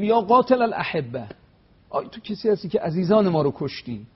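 retold woman yelling at the imam, half-acted; retold shout. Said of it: "یه زنی فریاد زد".

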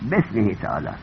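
"بسمه تعالی" run together, be straight into s; low, soft, joined "بسمه", no hamza; without alef. Shown as a demonstration. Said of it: "بی الف نوشتن". («بسمه تعالی» سرهم، بی الف)

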